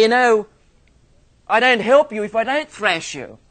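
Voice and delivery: mock stern father. Father warning his son about thrashing, mock stern. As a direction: lay down the law.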